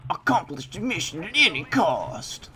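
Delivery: loud barking military order; shouted soldier command. Retold or imitated. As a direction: bellow it like a drill sergeant. Echoing the game's soldier voice.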